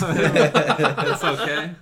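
laughing. Real, not acted. Laughing off watching the movie.

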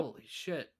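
very soft, stunned swearing; stunned disbelief. Real, not acted. Co-host taking in how many years have passed, hushed disbelief. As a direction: swear under your breath in amazement.

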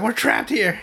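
high, mock-scared squeak; panicky bandit. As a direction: play a frightened dim henchman.

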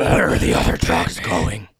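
deep Batman voice. Shown as a demonstration. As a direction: drag it out dark and deep. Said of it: "It's, like, dark and deep".

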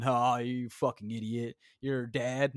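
ordinary talk, nothing marked

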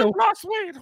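very high, laughing mouse voice; Mickey Mouse impression. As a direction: squeak it out mid-laugh. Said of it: "That's a horrible impression".

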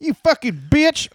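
high, angry swearing; Jim Cornette swearing tirade. Retold or imitated. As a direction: snarl the insult. Continuing Cornette impression.

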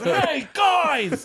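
high shouted call. Retold or imitated. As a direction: call up cheerfully. Calling out, retold scene.

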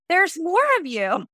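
a gently imitated gasp of wonder; mock astonishment. Imitating her husband seeing her siblings, mock amazed. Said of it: "he's like".

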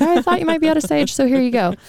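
high, quick, sing-song casual offer; breezy offer. Retold or imitated. Gifting a friend sage for no reason: high, quick, breezy offer. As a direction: toss it off brightly and quickly, like it's no big deal. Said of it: "Just be like".

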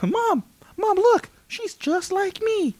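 put-on very high, drawn-out little-kid voice; excited kid. Kid telling mom she's just like me, childish wonder. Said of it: "every kid was like".

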